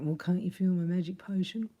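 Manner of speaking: teasing breathy secret whisper; mock whisper. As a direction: whisper it like a love spell. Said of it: "And I was like".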